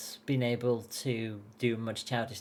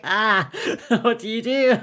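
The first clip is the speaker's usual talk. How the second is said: high, loud flustered cry with laughter; laughing, helpless panic acted out; mock panic; 10 semitones higher, 8 dB louder than usual. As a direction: play up being totally overwhelmed. Acted panic at nearly crying.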